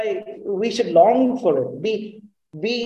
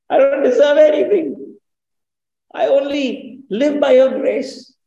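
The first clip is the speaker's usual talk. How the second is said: fervent plea; 6 semitones higher, 6 dB louder than usual. Acted. Preacher voicing Job's plea to God, loud and fervent. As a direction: cry it out as a humble believer.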